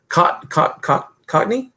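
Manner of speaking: "Cockney" tried haltingly, rising high at the end; unsure pronunciation. Real, not acted. Asking the Briton about an accent term, unsure pronunciation of "Cockney". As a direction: sound out "Cockney" in broken starts, ending as a question. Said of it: "am I saying it right".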